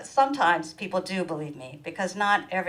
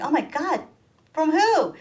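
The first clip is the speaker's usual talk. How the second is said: higher, surprised gasp of a question; shocked; 8 semitones higher, 6 dB louder than usual. Retold or imitated. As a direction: play the shocked daughter. Shocked reply to mother.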